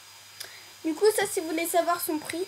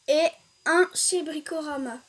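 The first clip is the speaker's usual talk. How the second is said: ordinary delivery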